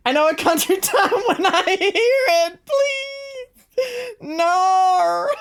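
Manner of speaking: very high, loud laughing shriek; mock wailing protest. Streamer protesting about Country Time, hammed-up high wail.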